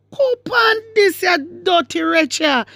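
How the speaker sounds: high, slow, drawn-out insult; indignant scolding. Real, not acted. Host berating the woman from the clip, indignant. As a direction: scold with the pitch way up, stretching the words.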